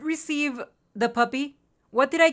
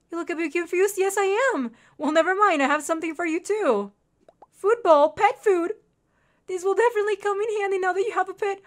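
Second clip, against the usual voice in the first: high, excited rush of reading aloud; 8 semitones higher, 5 dB louder than usual. Real, not acted. Player reading shopkeeper's news of pet food, excited. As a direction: read it high and giddy, bubbling over. Reads dialogue, thrilled about pet.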